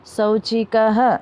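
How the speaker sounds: शौचिकः very slowly, ending in "ha"; model pronunciation. Shown as a demonstration. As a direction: draw out शौचिकः, visarga as "ha". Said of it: "उनको भी उच्चारण करना है".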